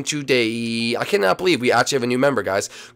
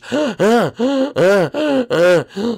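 high gasp; 10 semitones higher, 3 dB louder than usual. Acted. Bird wakes up in panic.

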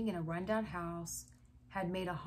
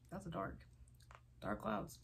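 ordinary delivery, nothing marked

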